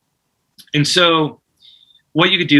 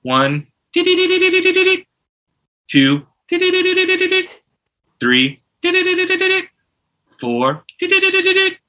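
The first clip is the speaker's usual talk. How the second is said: high imitated dialer beeps between numbers; louder, high beeping between each number; counting with beep tones; 3 dB louder than usual. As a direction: count and beep like the tone box, pitched way up. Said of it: "So you go".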